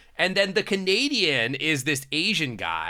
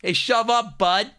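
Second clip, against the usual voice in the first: loud, higher Canadian hockey bro; Ontario hockey bro accent; 8 semitones higher, 4 dB louder than usual. Acted. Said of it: "they don't talk like that".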